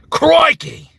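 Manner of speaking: loud, high Australian shout; big put-on Australian holler; Australian exclamation. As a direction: yell it like an excited Australian. Australian catchphrase called out.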